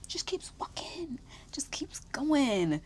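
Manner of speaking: hushed disbelieving whisper; whispered aside. Retold or imitated. She walks off.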